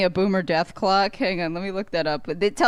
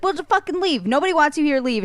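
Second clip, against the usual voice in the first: higher, mock-shouted dismissal; mock yell; 6 semitones higher than usual. Retold to a friend, his own higher mock-yelled "leave" at the boomer. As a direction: tell her to leave in a half-acted yell. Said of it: "I was like".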